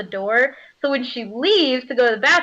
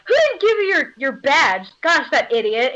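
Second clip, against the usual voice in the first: acted exasperation, pitched up at first; criticizing; 3 semitones higher than usual. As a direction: scold an absent culprit. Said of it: "you know, criticizing".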